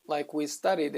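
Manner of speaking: ordinary talk, nothing marked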